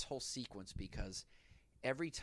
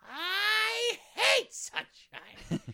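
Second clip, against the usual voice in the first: high, slow, shouted villain voice; villain's hateful shout; 11 dB louder, much slower than usual. Retold or imitated. To the co-host, quoting the villain in a shrill shout. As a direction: scream out hating the sunshine.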